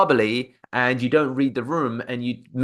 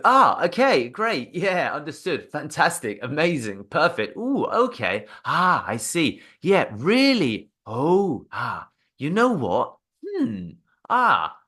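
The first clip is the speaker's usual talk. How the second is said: varied, expressive listener noises; an array of upbeat fillers; lively filler sounds; 4 semitones higher, slower than usual. Shown as a demonstration. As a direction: keep the caller sounding engaged. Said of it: "filler words ... sounds".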